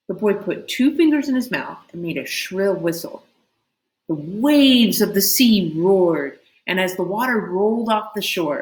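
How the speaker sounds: plain reading voice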